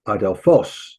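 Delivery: "adelphos" stressed on the final syllable, adel-PHOS; last-syllable accent. Shown as a demonstration. Teacher demonstrating the acute accent, final-syllable stress. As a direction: lift the end of "adelphos". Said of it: "the accent here on the last syllable".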